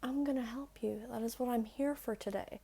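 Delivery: soft reassuring whisper. Acted. Teacher leaning in to reassure viewer, whispering. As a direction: whisper it like a secret promise.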